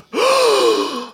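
sharp high-pitched gasp; shocked intake of breath; collective gasp. Acted. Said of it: "You hear a collective gasp".

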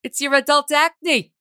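put-on loud, high, slow Staten Island accent; Staten Island hairstylist drawing it out loud. Hairstylist commenting on client's breakout, loud Staten Island accent. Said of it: "she'd be like".

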